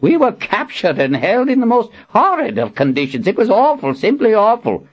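put-on high, theatrical old man complaining; old wizard complaining. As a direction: grumble dramatically like a fussy wizard. Zed complaining dramatically.

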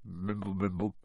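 soft, indistinct mumble; whispered mumble. A narrator's reading. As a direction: murmur nonsense under the breath. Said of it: "whispered something that sounded like".

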